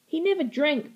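hurt, insistent denial; protesting. A narrator's reading. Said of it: "protested Stella".

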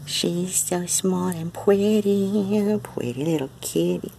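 high, slow sing-song coo. Real, not acted. Owner cooing baby talk to her cat.

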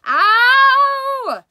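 very high, very loud cry of pain; pained yell. Acted. Husband yelling as wife yanks glued hat, loud scream. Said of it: "let out a yell that rattled the window panes".

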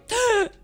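loud high-pitched mock gasp; dramatic gasp. Streamer gasping before checking on housemate, hammed-up gasp. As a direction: suck in air theatrically. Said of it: "dramatically gasping for air".

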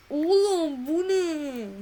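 drawn-out shocked cry. Real, not acted. Shocked at game event.

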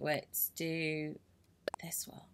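whispered to oneself; very soft, unvoiced aside; absent whisper. Real, not acted. Gamer reading a menu option to herself, whispered. Murmurs game menu while busy.

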